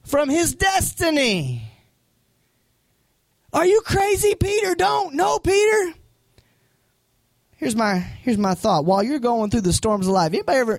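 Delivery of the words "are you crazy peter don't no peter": much higher, desperate calling out; pleading shout. Retold or imitated. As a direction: beg him not to step out. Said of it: "trying to hold Pete back".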